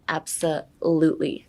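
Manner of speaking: ABSOLUTELY slowed, with weight on LUTELY; stress on LUTELY. Shown as a demonstration. Teacher showing where emphasis goes, slow ABSOLUTELY stressed on LUTELY. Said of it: "put the emphasis on the lutely".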